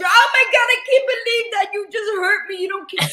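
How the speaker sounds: high, loud, hammed-up wail of hurt; mock melodrama. Mocking people who cry foul at the truth, melodramatic high wail. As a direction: whine it like an over-the-top victim.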